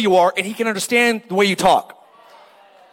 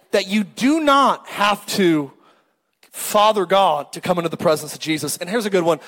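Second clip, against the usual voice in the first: ordinary delivery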